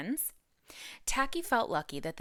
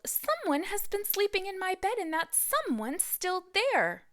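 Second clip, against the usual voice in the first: much higher, squeaky cub voice; squealing baby bear; 14 semitones higher than usual. Acted. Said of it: "Baby Bear squealed".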